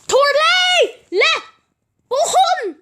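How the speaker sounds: high, loud shriek of a mother; mother yelling at her son in made-up tongue; yelled. Acted. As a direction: scream "Toilet" up high. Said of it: "yelled his mother".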